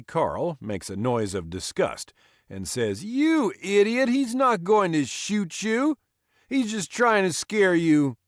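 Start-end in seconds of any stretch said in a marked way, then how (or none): 3.0-8.2 put-on much higher, louder sneering drunk voice; scornful drunk. Drunk Carl scolds wet Glenn, higher and louder. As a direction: bark at his buddy in a high voice.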